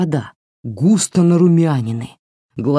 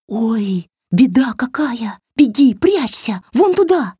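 rising, high panicked doll voice; terrified doll; 7 semitones higher than usual. A narrator's reading. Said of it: "В голосе куклы был такой ужас". (испуганный голос куклы)

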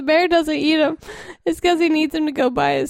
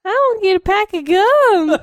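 put-on dumb kid voice; 5 semitones higher, 5 dB louder than usual. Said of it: "That's how the kid talks".

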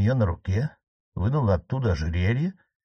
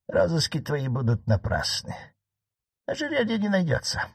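old marquise, voiced higher, lightly ironic; 7 semitones higher than usual. Aunt teasing her nephew the judge, voiced higher. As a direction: needle the judge in a higher aunt's voice.

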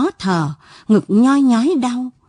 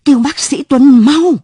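higher, louder cry for the doctor; frantic shout; 5 semitones higher, 6 dB louder than usual. A narrator's reading. Wife screaming for the doctor as her husband collapses, panicked shout. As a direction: yell for help in a panic. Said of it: "tiếng bà thét giật".

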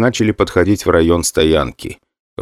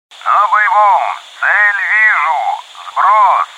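much higher, urgent combat calls over radio; radio chatter; 11 semitones higher, much slower than usual. Acted. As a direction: shout the report through crackling comms. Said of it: "докладывали экипажи о боевом применении". (боевой радиообмен, высоко и напряжённо)

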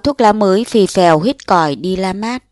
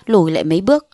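ordinary delivery

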